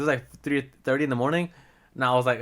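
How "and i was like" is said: ordinary delivery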